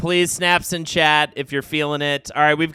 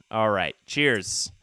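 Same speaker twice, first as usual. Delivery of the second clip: ordinary talk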